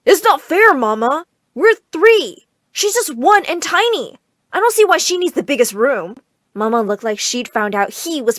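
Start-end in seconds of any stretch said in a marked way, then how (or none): 0.0-6.2 put-on high-pitched indignant child's complaint; indignant, emphatic protest. A narrator's reading. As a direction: argue that it's unfair, voice raised. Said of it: "tried to protest".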